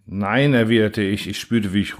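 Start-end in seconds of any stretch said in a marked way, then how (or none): none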